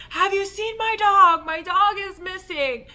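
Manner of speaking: high, loud calling out to strangers; retold yelling. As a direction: call out for a lost dog, high and loud. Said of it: "I'm yelling at people".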